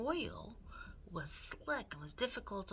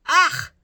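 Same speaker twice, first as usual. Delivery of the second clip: loud, high shriek of disgust; 12 semitones higher, 21 dB louder than usual. A narrator's reading. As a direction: shriek at the mouse in the oil. Cook's disgusted cry.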